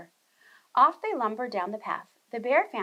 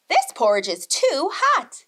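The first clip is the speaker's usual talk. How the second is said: complaint; 3 semitones higher, 7 dB louder, slower than usual. A narrator's reading. Goldilocks burned by hot porridge.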